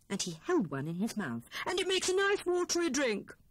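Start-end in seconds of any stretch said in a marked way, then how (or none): 1.7-3.4 high, eager Hare voice; bubbling over with his find; excited. A narrator's reading. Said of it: "said he excitedly".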